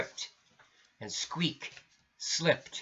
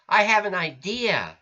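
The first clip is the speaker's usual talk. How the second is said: eager; 6 semitones higher, 6 dB louder than usual. Acted. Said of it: "said Squeak".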